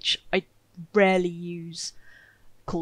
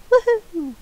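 high, loud whoop of joy; cheer; 10 dB louder than usual. Acted. Streamer cheering her healed visitors off the map, loud high whoop. Cheering colonists leaving healthy.

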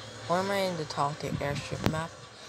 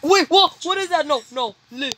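high, loud panicked yelp; startled and rattled, voice jumps up; scared horror game streamer; 8 semitones higher, 7 dB louder than usual. Real, not acted. Sudden panic on seeing a monster.

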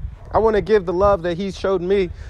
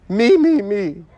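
mock selfishness; 3 semitones higher, 5 dB louder, slower than usual. Mocks selfishness as self-centred chant.

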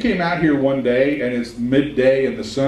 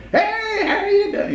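much higher, sugary fake-friendly greeting; fake cheerful. Mocking the fakers who greet people with forced cheerfulness. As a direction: chirp it with put-on cheer. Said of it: "we all know the fakers".

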